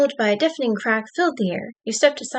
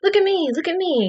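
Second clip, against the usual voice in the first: higher, eager show-off call; excited fish; 7 semitones higher than usual. Acted. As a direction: bounce it like a hyper little fish.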